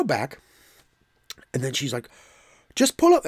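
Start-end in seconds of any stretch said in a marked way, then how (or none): 2.7-3.3 high, loud barked order through the window; retold shout. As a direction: imitate the cashier yelling the order. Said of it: "she's shouting at me".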